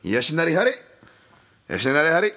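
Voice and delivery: Horii said high and fast as hoh-tee, mock Japanese style; high excited mock Japanese name call; mock Japanese accent. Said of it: "my almost borderline sounding offensive".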